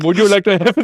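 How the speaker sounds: high, giggly attempt at a Filipino accent; bad Filipino accent. Air steward offering a drink, bad Filipino accent. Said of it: "can't do the accent".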